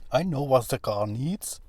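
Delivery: mock the engineers in a bad Russian accent; terrible Russian accent. Mocking German engineers with a bad Russian accent. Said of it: "terrible Russian accent".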